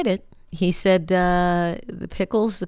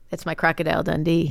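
ordinary delivery

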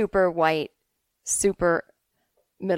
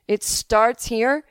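slow, louder, drawn-out disbelief; incredulous question; 3 semitones higher, 5 dB louder, much slower than usual. Acted. Mark's last incredulous question, acted.

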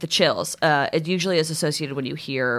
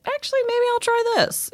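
much higher, playful voice of a curious web user; mock curious searcher. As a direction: pitch it way up like someone trying something new. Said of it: "and then they'll be like".

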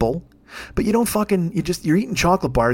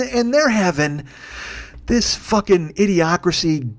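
usual speaking voice

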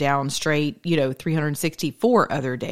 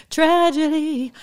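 high, slow sung-out tragedy; mock dramatic; 10 semitones higher, slower than usual. Mock-dramatic Tragedy sung to viewers. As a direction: stretch Tragedy up high like a lament.

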